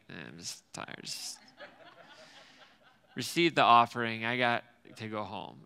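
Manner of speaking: a low, sleepy mumble, barely bothering; mock tired mumble. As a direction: drop way down as if too tired to care.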